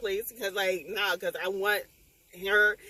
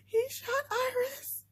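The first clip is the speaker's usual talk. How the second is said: high, slow, stunned disbelief; telling viewers the heroine got shot, stunned; shocked; slower than usual. Real, not acted. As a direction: let the shock stretch each word. Shocked by the plot.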